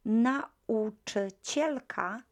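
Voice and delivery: Nauczycielka said slowly, stressed cie; slow model word. Shown as a demonstration. Teacher modelling Nauczycielka for class. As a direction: sound out Nauczycielka. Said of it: "teacher models word".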